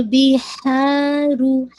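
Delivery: slow بِحَارُ with ha held two counts; elongated recitation. Shown as a demonstration. Teacher modelling the vowel length for students. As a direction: stretch the alif in بِحَارُ. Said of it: "ہاں کو ٹو count".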